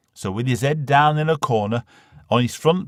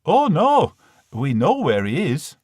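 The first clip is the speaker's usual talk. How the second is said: bright, much higher mother voice; a chirpy unbothered mum; cheerful mum; 5 semitones higher, 4 dB louder than usual. Acted. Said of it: "Ma said".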